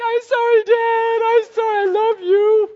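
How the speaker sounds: very high, loud mock child wail; wailing toddler. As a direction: squeal a hammed-up tearful apology like a toddler. Imitates toddler begging forgiveness.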